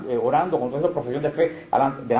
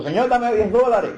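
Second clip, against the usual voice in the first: mock louder plea; 6 semitones higher, 3 dB louder than usual. Rival prayer answering back louder.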